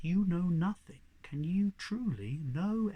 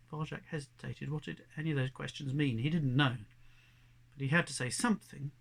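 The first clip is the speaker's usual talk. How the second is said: ordinary delivery, nothing marked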